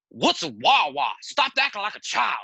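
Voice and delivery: imitating a scolding Southern buddy; Big Daddy voice. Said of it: "that Big Daddy voice".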